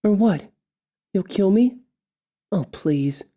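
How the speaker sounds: sarcastic elf. Acted. Elf taunting the orc king, high and sarcastic. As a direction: pitch it way up and scoff. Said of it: "she continues sarcastically".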